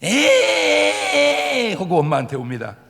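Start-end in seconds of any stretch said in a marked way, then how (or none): 0.0-1.7 long high bawling "ehhh"; loud drawn-out crybaby wail; child's mock wail. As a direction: imitate a little brother bawling. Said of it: "동생은 이구동성으로 하는 스타일이 똑같아".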